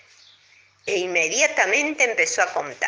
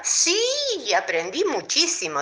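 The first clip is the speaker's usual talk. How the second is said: flea voice; 7 semitones higher than usual. A narrator's reading. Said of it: "dijo la pulga".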